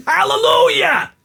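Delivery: high, loud, drawn-out hallelujah; jubilant shout. Acted. Celebrating freedom from sitting next to his wife, ecstatic shout. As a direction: belt it like a gospel cry of relief.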